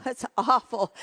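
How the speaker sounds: said through a laugh; amused disbelief while laughing; chuckling at the awful singing. Real, not acted.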